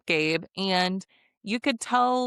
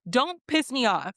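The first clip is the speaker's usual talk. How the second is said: mock angry retort; 6 semitones higher than usual. Voicing her own retort at Sincere, mock angry and higher.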